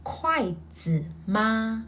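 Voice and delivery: slow, careful Mandarin model sentence; slow demo of 你会用筷子吗; slow model phrase. Shown as a demonstration. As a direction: say it slowly for learners to repeat. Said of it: "Say it with me".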